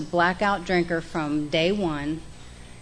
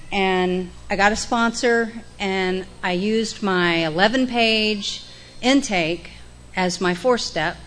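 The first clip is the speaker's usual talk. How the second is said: ordinary delivery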